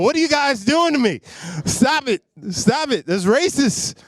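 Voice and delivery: mock protest. Mock protest to audience laughter.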